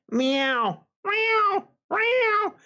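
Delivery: mock cat cries, very high; imitated meowing. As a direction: meow like an upset cat, climbing high. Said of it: "the cats, they just keep going".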